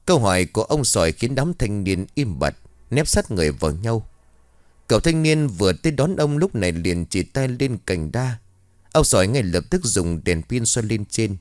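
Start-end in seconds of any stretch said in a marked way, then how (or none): none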